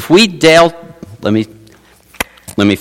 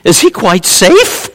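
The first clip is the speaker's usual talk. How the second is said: put-on timid girl asking; 7 semitones higher than usual. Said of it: "He goes".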